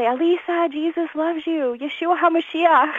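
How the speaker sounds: sing-song imitation of a cheerful witness; imitating Alan. Retold preacher greeting her.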